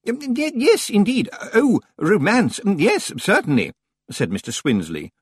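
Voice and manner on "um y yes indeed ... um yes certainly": high, stammering librarian, louder; falter, then agree with relief; relieved senior librarian. A narrator's reading. Senior librarian answering the stranger, high and stammering. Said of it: "looking relieved".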